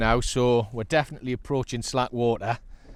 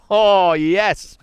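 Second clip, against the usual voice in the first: jubilant; 4 semitones higher, 9 dB louder, much slower than usual. Real, not acted. Celebrating the catch aloud.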